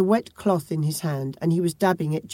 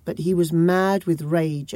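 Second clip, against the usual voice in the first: ordinary delivery, nothing marked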